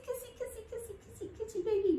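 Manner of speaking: put-on high, soft cooing to a baby; tiny high voice, softly doting on baby; baby talk. Shown as a demonstration. Instructor lowering down to kiss baby, soft high baby talk. Kissing baby during yoga push-up.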